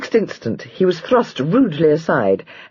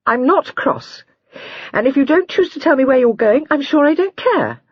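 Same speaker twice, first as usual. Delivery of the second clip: put-on higher young wife's voice, huffy and offended; cross young wife; 11 semitones higher than usual. A narrator's reading. Wife insists to her husband she isn't cross, huffy. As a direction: toss it off as if you truly don't care. Jenny denies being cross.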